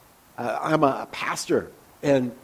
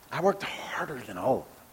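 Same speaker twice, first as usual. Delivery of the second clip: much softer, musing echo; quiet ironic repeat; 3 semitones lower, 11 dB quieter than usual. Shown as a demonstration. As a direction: turn the boast over skeptically under your breath.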